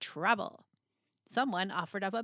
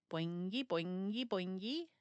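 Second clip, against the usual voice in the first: softer, springy rising boings; bouncy sound effect; 6 dB quieter than usual. Acted. Narrator voicing gear bouncing free, soft springy boings. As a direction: bounce each word up like a spring. Things bouncing off yet again.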